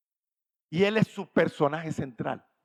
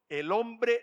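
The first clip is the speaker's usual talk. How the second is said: ordinary talk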